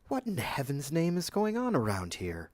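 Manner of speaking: baffled, voice jumping up in confusion; flustered, confused. A narrator's reading. Kurt finds Muriel at the fountain, flustered and confused. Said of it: "flustered and confused".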